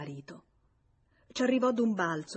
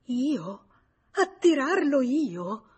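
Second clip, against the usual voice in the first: high, loud, indignant questions; slow, stretched, incredulous indignation; livid; 6 semitones higher, 7 dB louder, much slower than usual. A narrator's reading. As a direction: throw the accusation back, outraged. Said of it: "Lei mormorò livida".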